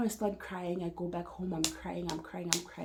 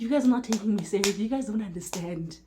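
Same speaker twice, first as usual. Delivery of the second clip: retold protest, louder and faster at the start; frustrated protest; 3 semitones higher, 7 dB louder than usual. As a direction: blurt it out, reenacting your frustration at not being believed. Pleading with men who dismiss her worry.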